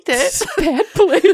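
squeaky laughing echo. Real, not acted. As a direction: laugh out the repeat. GM repeats, laughing.

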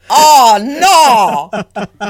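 mock horrified. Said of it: "she's like".

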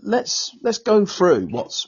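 steady a struggling friend, slowing on the last words; retold calm advice. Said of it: "and I said".

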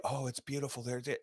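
soft, meek courtier praise; hushed flattery. Retold or imitated. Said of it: "what does everybody do?".

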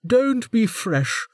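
slow, high, sharp rebuke; snapped. A narrator's reading. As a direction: scold him from on high. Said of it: "the warden snapped".

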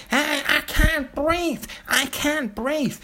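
sarcastic high-pitched mimicry of a protester; mocking protester cry. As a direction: parody the cry in a high, drawn-out put-on voice. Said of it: "shout out".

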